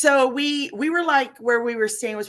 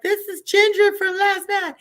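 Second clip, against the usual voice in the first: high, raised mock announcer voice; hammed-up holler, pitched way up; imitated screaming announcement; 10 semitones higher, 5 dB louder than usual. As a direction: announce a returning star to the room. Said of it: "and she's screaming".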